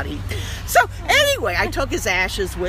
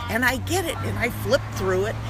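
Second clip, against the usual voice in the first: ordinary delivery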